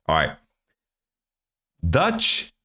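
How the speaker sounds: ordinary talk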